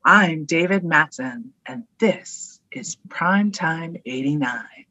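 loud, very slow mock announcer; drawn-out, booming impression of a male radio host; David Matson. Shown as a demonstration. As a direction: announce it slowly and loudly, imitating the host. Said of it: "Can you do me?".

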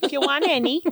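high, friendly Midwestern offer; Midwestern accent. Shown as a demonstration. Offering casserole in her Midwestern accent. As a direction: keep the Midwestern mom voice going. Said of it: "my Midwestern accent".

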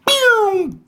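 high, loud sound effect; sudden high-pitched "boom" of a struck ball; imitated impact boom. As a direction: explode it like a cartoon impact. Imitates the shot being struck.

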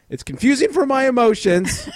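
high, loud hammed-up complaint; mock-wounded, high-pitched outburst; mock distress. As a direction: whine it high for laughs. Hammed-up complaint about colleagues.

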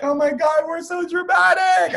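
hammed-up shout of drama; mock dramatic outburst. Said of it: "I'm like".

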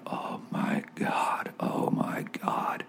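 slow, breathless whisper of shock; awed whisper. Retold or imitated. Praying man whispers in shock at the vision, slow hushed whisper. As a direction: whisper it, stunned by what you just heard. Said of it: "And I went".

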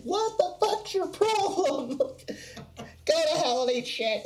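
high, slow, laughing jeer; mock angry crowd. Retelling the crowd's fury: high laughing jeer. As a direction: sneer it like outraged fans.

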